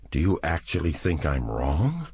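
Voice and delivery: ordinary delivery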